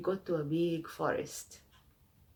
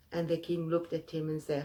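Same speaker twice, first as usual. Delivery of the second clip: plain reading voice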